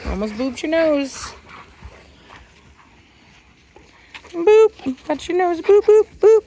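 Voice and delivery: very high squeaky boop. Real, not acted. Owner booping her dog's nose in a high playful voice. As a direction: play with the dog in a high cutesy voice.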